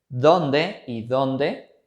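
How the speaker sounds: dónde y dónde drawn out very slowly; each dónde said slowly and set apart; slow contrast of dónde. Shown as a demonstration. Teacher drawing out dónde y dónde very slowly. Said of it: "para distinguir la función".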